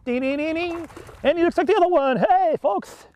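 high, bell-like gleeful calling; excited. Real, not acted. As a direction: ring it out like a winner's bell. Landing fish, cheering.

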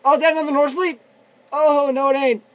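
loud, high exclamation; excited alarm. Real, not acted. Player spotting another enemy in the game, excited alarm. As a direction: call it out in surprise.

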